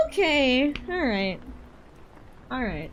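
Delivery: ordinary talk, nothing marked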